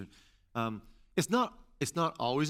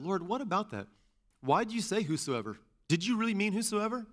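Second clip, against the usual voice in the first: higher-pitched, probing questions; questioning God; 6 semitones higher than usual. Acted. Believer asking God about a word in the verse, questioning. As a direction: press the question, lifting the pitch. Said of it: "have a conversation with God about it".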